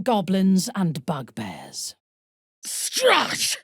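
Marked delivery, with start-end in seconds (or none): 2.7-3.6 Strahd! shouted high and sharp, far higher than usual; alarmed cry. Acted. Shouts the vampire's name in alarm.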